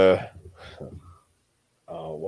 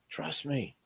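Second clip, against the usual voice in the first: hushed mock promise; 4 semitones higher, 11 dB quieter than usual. Someone reassuring with a promise.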